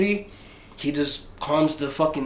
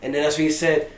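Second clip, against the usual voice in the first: usual speaking voice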